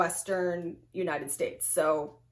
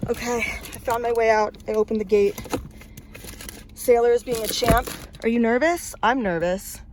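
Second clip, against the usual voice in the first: louder, keyed-up relief; shaky excitement at getting out; nervous relief; 2 semitones higher, 3 dB louder than usual. Real, not acted. Talking to her dog Sailor after escaping, nervous and loud. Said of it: "I am frantic".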